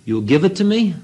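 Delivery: the guru hustling him, innocently; guru's voice. Retold or imitated. Guru asking for the car.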